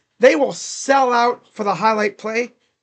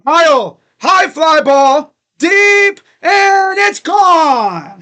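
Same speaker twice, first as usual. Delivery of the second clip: loud, high, drawn-out call building to gone; home run call; 6 semitones higher, 8 dB louder, much slower than usual. Real, not acted. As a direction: belt it like a radio announcer. Fan calls Kyle's home run.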